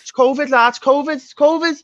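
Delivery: high, loud mock yell; imitated urgent warning. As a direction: cry it out like an urgent alarm, repeating it. Imitating a panicked lad's warning.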